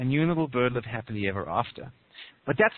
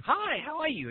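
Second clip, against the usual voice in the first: high-pitched chirpy little bird greeting; small bird voice, very high; 13 semitones higher than usual. Acted. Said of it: "the little bird says to you".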